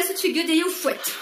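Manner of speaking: ordinary talk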